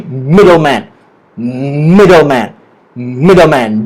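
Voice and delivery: "middleman" drilled with MID held, then falling; stress on MID. Shown as a demonstration. Teacher leading say-it-with-me practice, stress on MID in middleman. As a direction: lean on MID in "middleman", stepping down after it. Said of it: "The stress is on the word mid".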